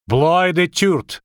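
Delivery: high, loud, slow foreign cry; exclaiming. A narrator's reading. Dryad crying out as the girl flees, exclaiming. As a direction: call it out high and drawn out. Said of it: "воскликнула Дриада".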